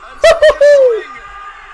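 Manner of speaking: high, joyful laugh; delighted laugh. Real, not acted. Laugh after goal.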